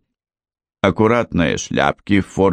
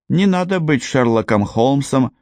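usual speaking voice

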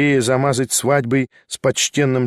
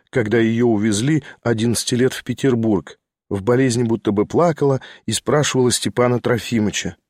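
ordinary delivery

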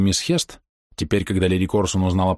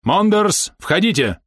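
high, commanding call; 11 semitones higher, 4 dB louder, slower than usual. A narrator's reading. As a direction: summon someone through the door. Полковник зовёт Мондерса войти. (громкий призыв войти)

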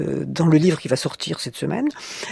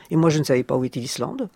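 usual speaking voice

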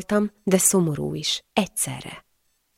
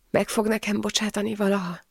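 soft, higher fragile plea; tearful question; 4 semitones higher, 6 dB quieter than usual. A narrator's reading. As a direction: ask timidly through tears. Weeping wife asks about forgiveness. (halk, sírós kérdés)

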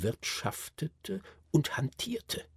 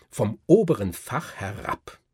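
ordinary delivery

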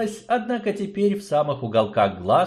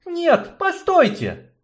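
high, urgent woman's voice; young woman interrupting; 4 dB louder, slower than usual. Acted. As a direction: cut in to stop them. Yarina intervenes.

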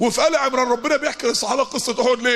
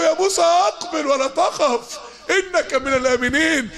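recited verse; 4 semitones higher, slower than usual. Acted. God's words recited as a verse.

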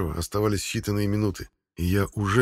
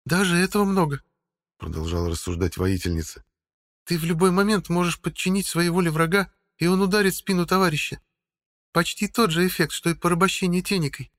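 higher, stern warrior woman; female voice; 10 semitones higher than usual. A narrator's reading. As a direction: speak as a grim woman. Helga reasons aloud.

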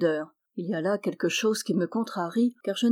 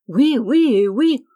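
loud, high, drawn-out yeses; vociferating; 5 semitones higher, 9 dB louder, slower than usual. A narrator's reading. Desperate younger prince agrees to marry, vociferating. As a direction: shout each yes emphatically, slowly. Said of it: "vociféra le cadet".

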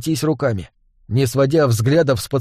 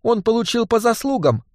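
prim indignation; 8 semitones higher than usual. Acted. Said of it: "поджала губы монахиня".

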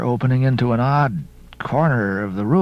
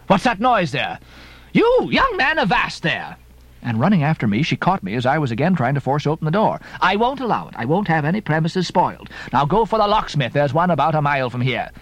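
landlady scolding; 3 semitones higher than usual. Acted. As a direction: scold the young man off her door. Landlady stops Ishmael forcing door.

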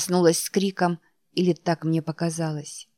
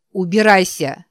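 shouting; 7 dB louder than usual. A narrator's reading. Panicked heroine shouts.

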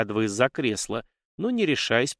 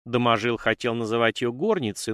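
plain reading voice

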